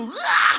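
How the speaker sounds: mock prayer yell. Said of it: "막 소리를 지르고". (소리 지르며 기도하는 흉내)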